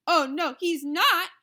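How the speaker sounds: loud indignant protest; rival mother. A narrator's reading. Another mother objecting to the turn, loud and indignant. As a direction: cut her off sharply.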